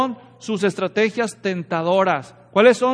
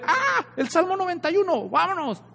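high mock alarm, then off; mock scared devil; 8 semitones higher than usual. The devil spotting Psalm 91 on the open Bible, high mock fright. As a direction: squeal and back away, hammed-up.

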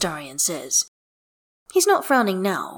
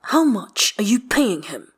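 slow, higher-pitched mild indignation; hissed; 4 semitones higher, 5 dB louder than usual. A narrator's reading. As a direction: press the question slowly, a touch accusing. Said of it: "he hisses under his breath".

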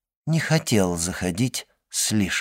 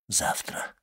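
whispering; 11 dB quieter than usual. Acted. Secret exchange continues in whisper.

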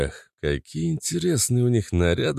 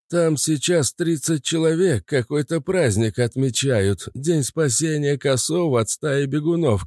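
put-on old man voice; 6 semitones higher than usual. Grandpa character, far timbre.

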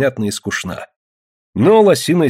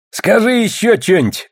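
high, teasing call; smirking taunt; 12 semitones higher than usual. A narrator's reading. Nalim teases Ksenia, smirking. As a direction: goad her on with a smirk. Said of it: "ухмыльнулся Налим".

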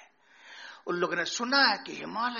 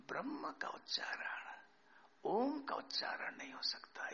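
hushed whisper; 4 semitones higher, 12 dB quieter than usual. Real, not acted. Hushed point about Brahman.

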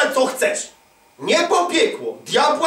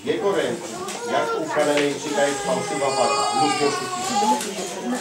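tearful reading; 3 semitones lower than usual. Shown as a demonstration. Preacher rereading Hosea to the congregation, voice breaking. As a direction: voice breaking into a cry at the end. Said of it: "W czyim ręku?".

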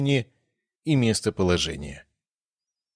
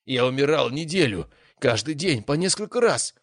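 indignant complaint; 7 semitones higher than usual. A narrator's reading. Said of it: "возмутился Леон".

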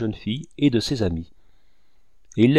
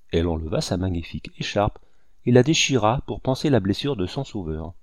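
ordinary delivery, nothing marked

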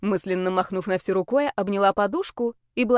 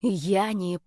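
understated menacing growl; threatening growl. A narrator's reading. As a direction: growl it as a warning. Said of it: "прорычала я с угрозой в голосе".

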